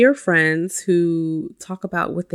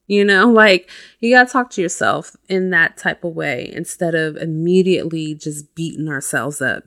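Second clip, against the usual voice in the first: ordinary delivery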